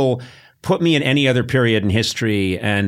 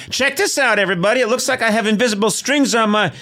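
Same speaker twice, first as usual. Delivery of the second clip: high, far-off bragging peasant voice; Irish peasant showing off; 12 semitones higher than usual. Retold or imitated. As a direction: show off a trick to the whole village. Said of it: "doing the string dance and going".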